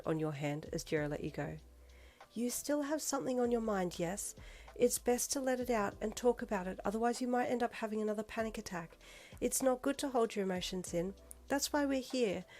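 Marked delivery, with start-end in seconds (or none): 2.3-12.4 soft, high and kindly. A narrator's reading. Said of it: "she said kindly".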